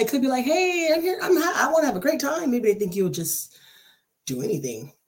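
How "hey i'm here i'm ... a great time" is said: put-on high, perky party-girl greeting; imitated eager friendliness. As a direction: play up being game for anything. Imitating an over-friendly vibe.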